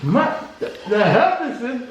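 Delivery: gasping at the spicy heat; burning mouth. Real, not acted. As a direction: mouth on fire from hot sauce. Eating very hot pizza.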